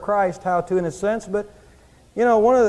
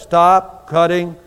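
booming voice; 3 semitones lower, much slower than usual. Retold or imitated. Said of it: "this booming voice".

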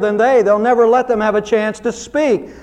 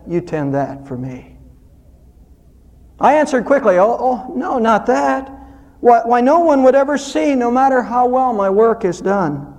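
poem recital; 4 semitones lower than usual. Acted. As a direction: read the poem gently, like a prayer.

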